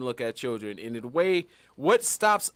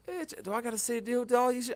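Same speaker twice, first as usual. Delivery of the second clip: higher, softer, exasperated; fed-up aside; 6 semitones higher, 6 dB quieter than usual. Retold or imitated. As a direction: ask it half to yourself, sick of it. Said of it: "I'm like".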